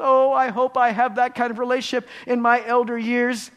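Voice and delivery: high, loud, gushing wish; wishful onlooker. Acted. Preacher voicing what we think to ourselves, high and wishful. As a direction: exclaim it as a naive, hopeful admirer. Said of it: "we think to ourselves".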